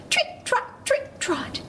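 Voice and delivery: high, crisp trip-trot; tiny goat trotting along; little hoof steps. A narrator's reading. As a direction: tap out light, high hoofbeats in words. Little goat's hooves crossing bridge.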